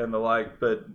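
ordinary delivery

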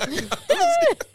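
shrieking burst of laughter; high-pitched laugh. Real, not acted. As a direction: squeal with laughter.

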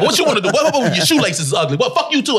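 fired-up confrontation. Retold or imitated. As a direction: act out being right on someone's case. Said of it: "I was just on 'em".

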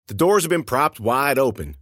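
ordinary delivery, nothing marked

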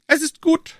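high, lofty royal voice; a king speaking down to his subjects; king's voice. Acted. King to Treville, high lofty royal voice.

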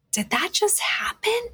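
high, airy incredulous question; stunned disbelief. Retold or imitated. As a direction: squeak it out as if you can hardly believe it. Retold stunned reaction to call.

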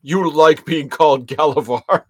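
bully taunt. Retold or imitated.

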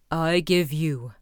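drawn-out low cruelty; cruel Bluebeard. A narrator's reading. Cruel husband granting little time, low and slow. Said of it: "replied the cruel Bluebeard".

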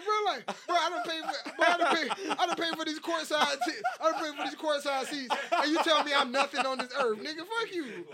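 high-pitched comic indignation, laughing through it; mock outraged fan. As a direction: sputter in fake outrage with repeated false starts. Acts out insulted courtside fan, laughing.

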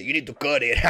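dad's imitated Cheech-like accent; catchphrase in a playful put-on accent, breaking into laughter; mock accent. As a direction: put on a light comic accent. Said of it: "he's saying it in an accent".

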